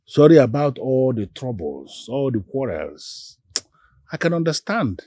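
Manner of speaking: calm apology. Acted. Man apologising to angry woman for beating, calm. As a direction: apologise gently and unhurriedly to placate.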